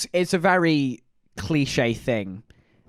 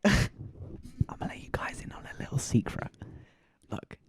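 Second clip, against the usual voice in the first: conspiratorial whisper with a laugh; secret whisper; 7 semitones higher, 8 dB quieter than usual. Acted. Letting viewers in on a secret, a playful whisper.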